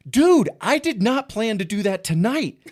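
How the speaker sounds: high, loud protest with a laugh; worked-up, pleading-loud comeback; exasperated retort. Retold or imitated. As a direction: push back loudly, half laughing. Retorts to club owner, retelling.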